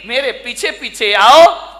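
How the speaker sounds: put-on slow, lisping little girl, rising at the end; lisping child voice. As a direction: babble it like a small child leading the way. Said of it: "तुतलाते हुए बोली".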